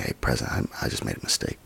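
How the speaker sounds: low, hushed admission; subdued, halting retold admission; quiet confession. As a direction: confess it softly to a church leader. Retells confession to church leader.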